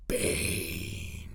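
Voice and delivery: low, breathy whispered "Bane". Shown as a demonstration. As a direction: growl the name low under the breath. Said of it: "I love saying it like that".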